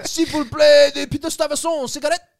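loud, high, pleading mock-French; hammed-up French accent, over-pronounced and loud; bad French accent. Tourist begging for cigarettes, bad French accent. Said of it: "my accent's no good".

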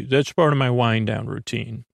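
ordinary talk

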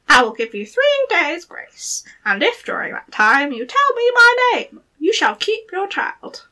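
high squeaky little goblin voice; gravelly sly hobgoblin. Acted. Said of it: "he said".

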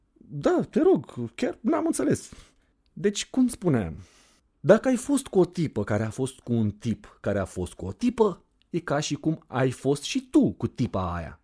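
high, mocking teen girl voice; sarcastic girl. Anda mocking the narrator over her kissing chart, sarcastic high girl voice. Anda's voice held.

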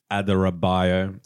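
slow careful syllables; said slowly. Shown as a demonstration. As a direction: spell out Adarabioyo slowly. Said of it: "I always have to, like, say it slowly".

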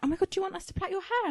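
bubbly stylist squealing the offer; eager hairdresser. Retold or imitated. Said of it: "And she was like".

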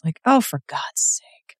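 an unvoiced exasperated aside; exasperated whisper. Real, not acted. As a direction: breathe it out, fed up. Exasperated aside about Mather.

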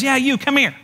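a touch of parrot heckle; parrot calling out. Acted. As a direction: call out like a cheeky parrot. Parrot summons customer.

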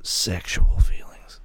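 breathy joking whisper. Real, not acted. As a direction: whisper it suggestively. Joking aside.

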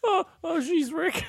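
very high, fretful cartoon voice; Morty. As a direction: stammer anxiously way up high. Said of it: "It's Morty now".

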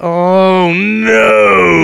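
loud drawn-out cry of dismay; dismayed outcry. Acted. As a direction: wail it loudly as the bill sinks in. Horrified at the bottle bill.

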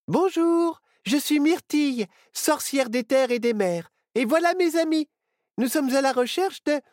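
put-on high bright girl's voice; young witch girl. A narrator's reading. Myrtille cheering on her friend, high girl's voice.

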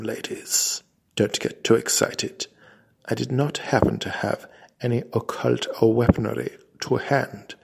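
ordinary delivery, nothing marked

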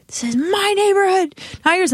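high-pitched territorial protest; retold indignation, high and insistent; indignant outburst.

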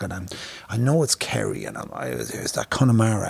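ordinary talk, nothing marked

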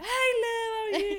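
high, loud bubbly hello; bubbly greeting. Retold or imitated. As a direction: greet her all bright and bouncy. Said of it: "I'll come in from a day like".

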